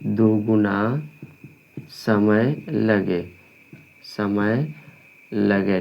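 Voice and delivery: slow dictation, long gaps between phrases. Real, not acted. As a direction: stretch each word out for note-takers. Dictating for students to write. (धीमे, ठहर-ठहरकर बोलकर लिखवाना)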